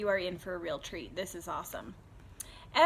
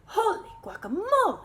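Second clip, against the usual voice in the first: much higher, crying out in surprise; startled exclamation; 10 semitones higher, 4 dB louder than usual. Acted. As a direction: gasp it at the mirror. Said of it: "he exclaimed".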